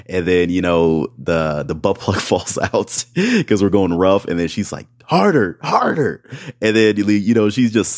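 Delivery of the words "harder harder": mock high moaning. Partner's moans quoted again.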